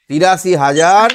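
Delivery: তিরাশি হাজার said slowly, rising at the end; slow teaching read-out. Shown as a demonstration. Teacher to pupil, slowly giving the answer তিরাশি হাজার. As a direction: draw out তিরাশি হাজার so the pupil can follow. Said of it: "তাহলে কত লিখবো".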